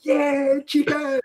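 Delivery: high triumphant announcement; a thrilled student calling out; excited kid. Retold or imitated. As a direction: shout the find like treasure. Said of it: "they'd run back out and go".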